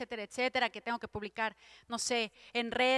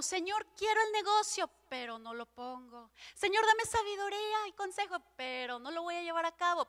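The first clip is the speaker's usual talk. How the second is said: higher, half-sung mock prayer that never commits; mocking an indecisive believer; 5 semitones higher than usual. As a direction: lightly mimic someone who asks but won't act. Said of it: "es como".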